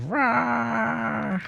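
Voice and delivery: high mock roar of strength; berserker roar. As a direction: roar like a raging bear, playfully. Minsc bursting his bars.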